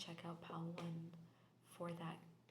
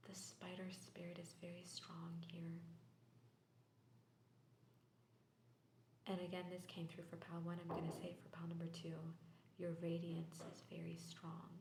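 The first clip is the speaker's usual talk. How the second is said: whisper. Real, not acted. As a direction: breathe the reading out in a hush. Tarot reading in whisper.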